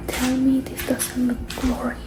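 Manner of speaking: ordinary talk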